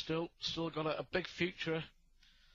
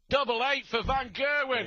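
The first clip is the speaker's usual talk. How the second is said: loud, high excited call; excited commentary; 7 semitones higher, 7 dB louder than usual. Real, not acted. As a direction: lift it as the dart lands. Commentator excited at winning double.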